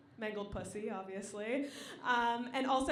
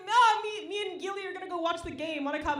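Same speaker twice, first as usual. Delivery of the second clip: chirpy friend; 5 semitones higher, 6 dB louder than usual. Retold or imitated. Quoting friend excitedly.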